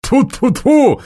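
quick high spitting sounds; superstitious spitting. A narrator's reading. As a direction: spit over the shoulder. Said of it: "суеверно поплевал Соболев".

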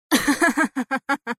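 staccato scornful ha-ha-ha; mocking laugh. A narrator's reading. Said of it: "ضحك أدهم ضحكة ساخرة عالية". (ضحكة ساخرة ممثلة)

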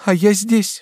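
high, loud anguished cry. A narrator's reading. Batilda helpless in bed, crying out. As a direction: exclaim it in distress. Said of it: "вскрикнула Батильда".

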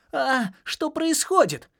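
tiny high squeak, slow and stunned; squeaky. Acted. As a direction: squeak it like a startled little critter. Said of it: "пискнул Джаспер".

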